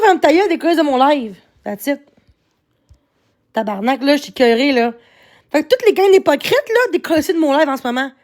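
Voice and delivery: ordinary talk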